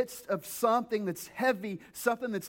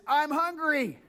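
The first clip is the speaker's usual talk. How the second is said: raised, whiny complaint; whiny hungry traveler; 6 semitones higher, slower than usual. Retold or imitated. Pastor mimicking a family member on a trip, mildly whiny. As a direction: whine it like a hungry kid in the car. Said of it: "I often hear is".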